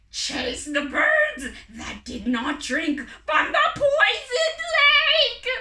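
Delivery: furious croaking red bird; croaked command. Acted. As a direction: bark the order in a monstrous croak. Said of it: "croaked the red bird".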